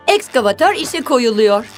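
usual speaking voice

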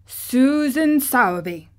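put-on old gardener.

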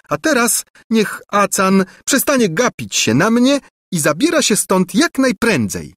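high, disdainful lecturing; haughty princess. A narrator's reading. Angelika sends her cousin away, high and disdainful. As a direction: talk down to him coldly.

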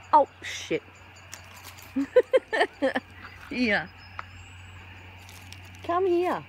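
high, sing-song voice coaxing a bird; pet talk. Acted. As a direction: coax the little bird over, sweet and high. Coaxing birds to come for food.

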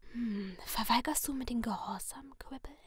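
sinister masked figure in a soft whisper; menacing whisper from behind a mask; creepy whisper. Acted. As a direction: purr the threat like a friendly killer. Letty whispers.

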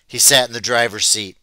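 plain reading voice

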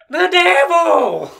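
high, slow, loud devil cry; hammed-up slow shriek; hammed-up shout. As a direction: wail it through laughter. Repeats punchline, hammed up.

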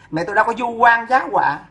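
ordinary delivery

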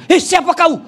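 high, loud startled question. Retold or imitated. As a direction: jump back and demand who it is. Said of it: "Ih, kaget suaminya".

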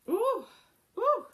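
sharp high yelps; startled ooh. Real, not acted. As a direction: yelp as the onion stings your eyes. Stung by onion fumes.

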